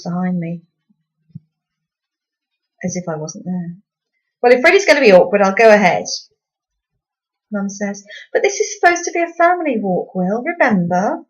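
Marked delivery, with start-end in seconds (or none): 4.4-6.0 irritated Dad. A narrator's reading. Dad snaps at family. 8.3-11.2 reproachful Mum. A narrator's reading.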